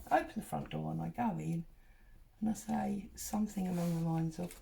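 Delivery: ordinary talk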